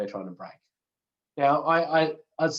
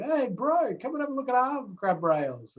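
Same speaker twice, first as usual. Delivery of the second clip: much higher, hammed-up New Zealand accent; 12 semitones higher than usual. Presenter quoting New Zealanders on grab rails, poor mock New Zealand accent. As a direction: imitate a New Zealander in a high, badly faked accent. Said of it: "that wasn't a good New Zealand accent".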